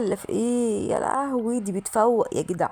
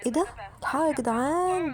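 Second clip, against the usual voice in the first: high-pitched call to the viewers; excited alert; 7 semitones higher than usual. Real, not acted. Gamer alerting viewers to the victim's next move, excited alert. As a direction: call the gang over in a raised pitch.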